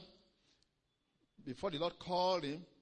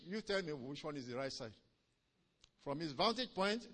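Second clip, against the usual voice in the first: ordinary talk, nothing marked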